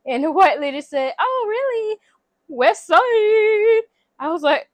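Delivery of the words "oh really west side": pitched-up imitated surprise; mock surprise. Neighbor lady hears they're from LA, mock surprised.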